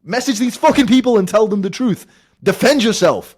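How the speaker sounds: screaming at a lazy self, very high and loud; furious shout. Acted. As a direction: roar it like your own brain yelling at you. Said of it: "your brain and your body telling you".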